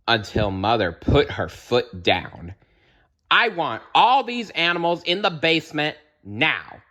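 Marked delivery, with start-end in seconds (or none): 3.3-6.8 high, firm motherly order. Acted. Mother ordering pets to the basement, firm. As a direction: lay down the law as mom. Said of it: "she ordered".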